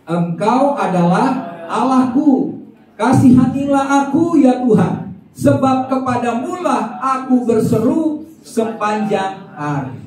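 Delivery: high, slow and loud, crying out the verse; fervent scripture reading. Acted. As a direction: proclaim the psalm like a plea to God. Reading the psalm verse aloud.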